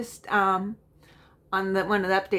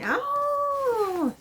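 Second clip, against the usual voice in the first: high surprised oh; a sudden high oh of discovery; delighted surprise at a tall pattern. Real, not acted.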